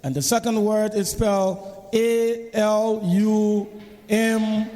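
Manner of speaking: slow letter-by-letter spelling. Shown as a demonstration. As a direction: spell each letter slowly. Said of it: "hear the moderator spell".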